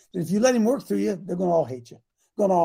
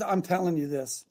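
usual speaking voice

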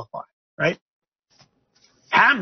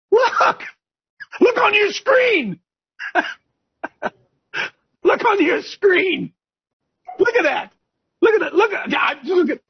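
high, excited calls to look; worked-up jab at the screen; excited exclaiming; 11 semitones higher than usual. Real, not acted. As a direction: urge them to look, pitched way up. Host points viewers at screen photo.

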